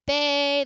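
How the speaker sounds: bay held long and lifted; drawn-out bay. Shown as a demonstration. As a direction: stretch bay as if calling a dog. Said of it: "that's longer is also higher".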